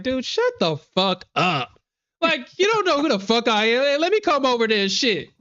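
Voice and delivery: playful fake fury; mock tough-guy rant. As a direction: pretend to menace the scammer, half laughing. Retells cussing out the scammer.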